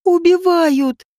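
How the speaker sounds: high, drawn-out squeal; screeched alarm. A narrator's reading. Женька shrieks as a fight looms, drawn-out squeal. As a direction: stretch the cry out high and slow. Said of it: "завизжала Женька".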